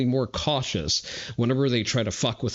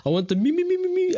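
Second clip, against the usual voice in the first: very high, squeaky mock whining; Carl mocking Macron; 3 dB louder, much faster than usual. Streamer gleefully copying a mocking rant, very high whiny babble. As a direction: squeal it out as a rapid mocking chant. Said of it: "Carl mocking Macron".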